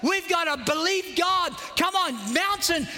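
high, driving sermon delivery; preaching at full force. Real, not acted. Preacher urging the congregation to believe God, full-force preaching. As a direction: preach it high with long, pressing phrases.

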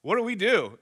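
calling out loudly across the restaurant; retold yell. Dad yelling to the bartender for help, loud call. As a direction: raise your voice to flag him down. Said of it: "I yell at the bartender".

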